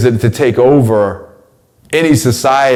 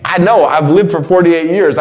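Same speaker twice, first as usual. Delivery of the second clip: ordinary talk, nothing marked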